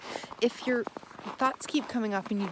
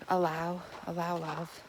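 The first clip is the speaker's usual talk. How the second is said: soft, hushed, lower self-reminder; gentle; 4 semitones lower, 5 dB quieter than usual. Shown as a demonstration. Teacher showing viewers a soft inner reminder, gentle and hushed. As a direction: breathe the words out softly. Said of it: "gently saying".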